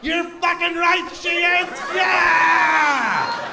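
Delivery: high, loud triumphant yell; cocky shout. Retold or imitated. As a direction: roar it like a champion. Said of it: "I'm like".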